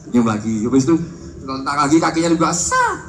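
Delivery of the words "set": a sudden high squeak on set. Retold or imitated. Comic imitates cheerleader's leg kick, high squeak. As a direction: pop the word up like a kick.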